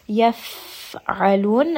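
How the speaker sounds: Yaf'alun very slowly, air escaping on the fa; slow breathy fa. Shown as a demonstration. Teacher repeating hams demonstration very slowly. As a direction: drag it out, breath leaking on the f. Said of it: "a little bit of air that's escaping".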